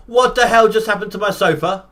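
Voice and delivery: loud, high-pitched disbelief; shocked outburst. Real, not acted. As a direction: yelp it out in startled alarm. Startled by sofa breaking in game.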